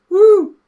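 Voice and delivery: high loud whoop like a party popper; party popper impression. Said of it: "Watch my actions".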